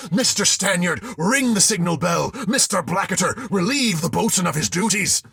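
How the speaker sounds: high, loud orders; commanding shout. A narrator's reading. Captain ordering the boatswain relieved, loud. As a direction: bark out commands.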